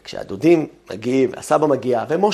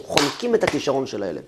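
usual speaking voice

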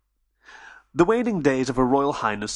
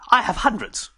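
Benchley character voice; 9 semitones higher than usual. Acted. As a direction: brush it off lightly.

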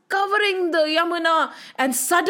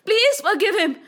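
pleading cries; 7 semitones higher than usual. Acted. Serpent's wife and children beg Krishna, high pleading. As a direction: plead urgently in a raised pitch. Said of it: "His wife and children started shouting".

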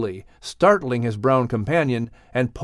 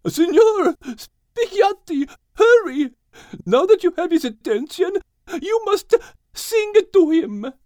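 urgent mare. A narrator's reading. The mare urging Max to sing to the stallion. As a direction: urge him to hurry.

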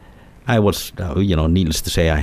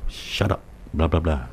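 breathy half-whisper, mostly unvoiced and softer; retold whisper; 6 dB quieter than usual. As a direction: mildly imitate a hissed hush from the dark. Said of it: "I could actually hear it saying".

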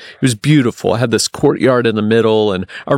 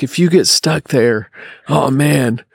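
imitating a friend's alarmed warning, slightly higher; mock freak-out; 4 semitones higher than usual. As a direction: retell a friend's dread, pitched up a little. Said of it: "he freaked out".

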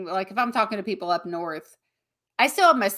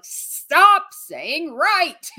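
slow, high, loud mock scold; high slow exasperated self-scold; scolding herself; 7 semitones higher, 6 dB louder, much slower than usual. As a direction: drag out each word at yourself. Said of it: "I'm like".

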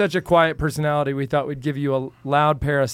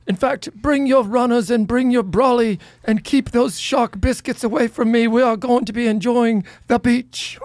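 higher-pitched hammed-up Aussie accent; an Australian accent, pitched up and overdone; mock Australian accent; 7 semitones higher than usual. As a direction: lay on a cheesy Aussie mate voice. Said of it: "you gotta see how bad they are".

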